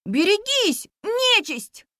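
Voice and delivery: high ringing battle cry; shouted warning. A narrator's reading. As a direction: call out a fierce warning. Dragon rider shouts warning.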